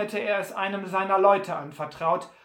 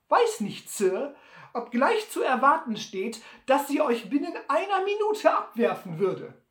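viel höher und lauter, verschmitzt; hoch und trocken wie der alte Trapper; Sam Hawkins; 8 semitones higher, 4 dB louder than usual. Acted. (Sam provoziert Butler hoch und verschmitzt)

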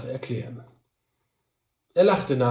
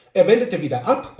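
ordinary delivery

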